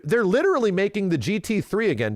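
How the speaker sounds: ordinary talk, nothing marked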